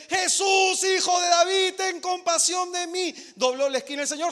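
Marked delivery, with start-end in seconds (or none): 0.1-3.1 loud, high cry after Jesus; mother shouting after Jesus; shouting plea. Acted. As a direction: shout his name, begging. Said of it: "Y la mujer".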